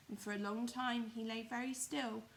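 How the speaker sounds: plain reading voice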